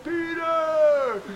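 loud call over the roar. Acted. Jesus calling Peter to the back, loud call. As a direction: shout his name across the boat.

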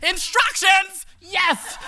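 high squealing thrilled voice. Retold or imitated.

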